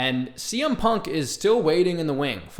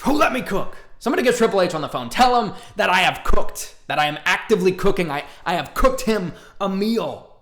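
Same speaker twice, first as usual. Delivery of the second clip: higher and louder, fast triumphant boasting; hyped bragging; 6 semitones higher, 6 dB louder than usual. Real, not acted. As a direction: crow about your genius prediction. Bragging about his own match prediction.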